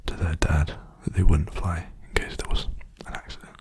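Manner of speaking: ordinary delivery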